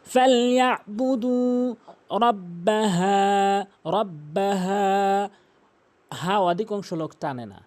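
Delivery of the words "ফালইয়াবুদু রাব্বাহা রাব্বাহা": slow chant with long final vowels; measured, elongated Quranic recitation; model recitation. Shown as a demonstration. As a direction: hold the end of ফালইয়াবুদু and রাব্বাহা. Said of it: "টেনে পড়বেন".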